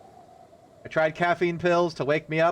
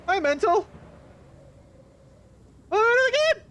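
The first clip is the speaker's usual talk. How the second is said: high, louder put-on squeaky voice; mock cartoon greeting; silly voice; 3 dB louder than usual. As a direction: greet it in a goofy cartoon pitch. Greeting a game object oddly.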